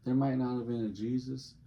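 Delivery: ordinary talk, nothing marked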